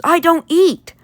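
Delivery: acted fed-up outburst; exasperated outburst. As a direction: protest in mock exasperation, pitch jumping up. Abe's exasperated outburst at robot.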